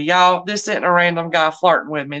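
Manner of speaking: usual speaking voice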